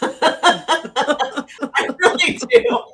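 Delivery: laughing it out, high and slow; a real giggle through the words. Real, not acted. Laughing as she admits to the listeners she has no filter.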